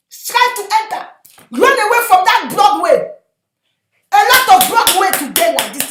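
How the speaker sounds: impassioned preacher crying out; full-force preaching. Real, not acted. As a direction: warn the congregation at full voice.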